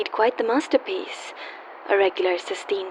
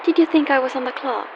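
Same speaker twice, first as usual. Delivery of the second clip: normal talking voice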